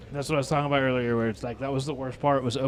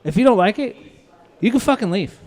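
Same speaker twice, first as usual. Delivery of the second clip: loud, higher, firm dismissal; heated ultimatum in a raised voice; retold angry ultimatum; 7 semitones higher, 8 dB louder than usual. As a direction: re-enact ordering a customer out. Retelling ordering rude customer out.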